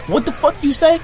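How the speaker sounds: high-pitched imitated challenge; mock confrontation. Acted accusation in a confrontation.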